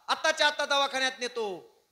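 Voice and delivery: high, loud and urgent; urgent promise. Acted. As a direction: shout that rescue is happening right now. Brother promises rescue.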